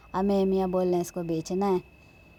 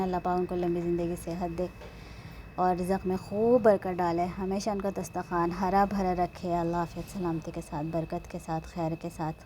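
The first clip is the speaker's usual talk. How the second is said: quiet prayer; 4 dB quieter than usual. Real, not acted. Praying for her sister's home, a soft fading dua. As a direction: murmur the dua gently to the end.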